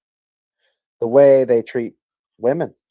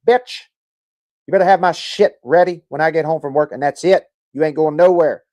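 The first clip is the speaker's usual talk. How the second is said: loud, higher, bossy pimp barking orders; mock pimp yelling at women; 5 semitones higher, 7 dB louder than usual. A guy imitating a pimp yelling at a woman, loud and bossy. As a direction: play the pimp ordering his women around.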